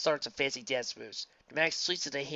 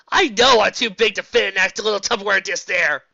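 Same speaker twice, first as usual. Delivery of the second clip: loud boast; 7 semitones higher, 14 dB louder than usual. Acted. Character boasts.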